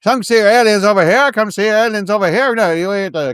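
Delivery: high, loud carnival barker call; ringmaster. Acted. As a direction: sell it like a snake oil seller. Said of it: "he's the ringmaster".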